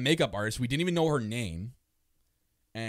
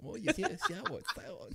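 mocking sour face; 11 semitones higher than usual. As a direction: imitate someone pulling a sour face while tasting. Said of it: "He's doing, like, the, that sour face".

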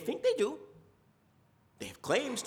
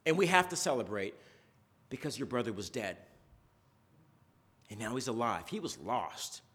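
much lower, softer proclamation; solemn preaching; 8 semitones lower, 3 dB quieter than usual. Acted. Preacher softly proclaiming the lost son is found. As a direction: let the good news land quietly. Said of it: "preaching the parable's climax".

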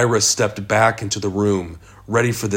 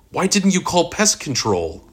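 high-pitched, appalled questioning; incredulous question; 9 semitones higher than usual. A narrator's reading. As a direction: ask it in high-pitched disbelief. Ira questions barman about dead mice.

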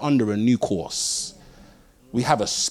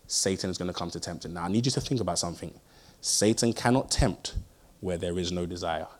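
ordinary delivery

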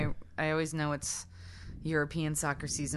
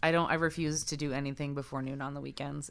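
ordinary talk

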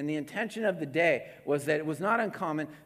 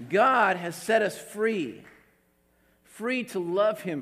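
slow, louder proclamation; proclaiming freedom; 4 semitones higher, 5 dB louder, slower than usual. Real, not acted. Preacher declaring to the congregation, slow and emphatic. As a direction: ring out each word of freedom.

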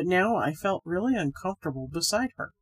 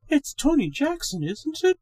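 high, light woman's voice; whispered woman's voice; 9 semitones higher, 3 dB louder than usual. A narrator's reading. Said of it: "she whispered".

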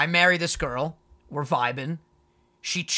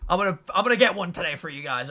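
ordinary delivery

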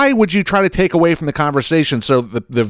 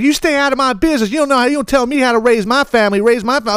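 high nasal-free mock voice, much higher; mocking the mother; 12 semitones higher than usual. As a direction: parody her defensiveness, pitched way up. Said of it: "He's like".

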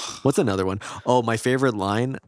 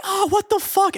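high-pitched mock yell of a wife; imitated outrage; 3 dB louder than usual. Wife waking in a wet bed, mock outraged. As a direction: squawk it as the outraged spouse.

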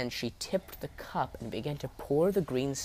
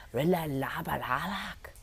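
slow rasping witch, pitched higher; 5 semitones higher than usual. Acted. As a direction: croak the old words like an ancient crone. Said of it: "she spoke in a rasping voice".

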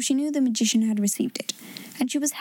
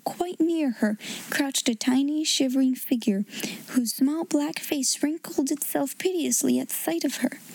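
ordinary delivery

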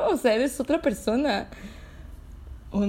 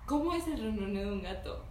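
usual speaking voice

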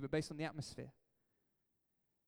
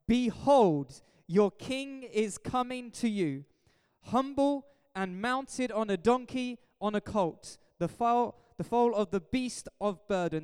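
slow, raised, proclaiming Bible voice; scripture reading; 4 semitones higher, 5 dB louder, slower than usual. Acted. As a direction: declaim the prophecy slowly and firmly. Reads prophecy aloud from Bible.

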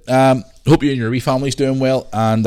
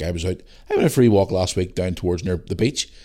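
ordinary talk

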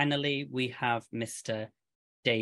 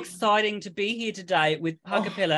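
higher-pitched hammed-up Australian accent; 6 semitones higher, 4 dB louder than usual. Host greeting the special guest from overseas, mock Australian accent. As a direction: put on a bad, excited Australian accent. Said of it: "Please never do that accent ever again".

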